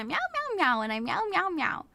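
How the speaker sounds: high, light singsong meows; playful little cat voice; kitty cat meowing. Pretend. Streamer playing kitty cat for chat, high meows. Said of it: "I'm a kitty cat".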